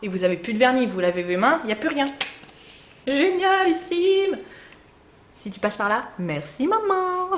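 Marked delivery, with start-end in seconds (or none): 3.1-4.4 drawn-out delight. Real, not acted. 6.2-7.3 high, slow little voice for the daughter; sweet childlike thank-you; mock child thanks. Voicing daughter thanking mom.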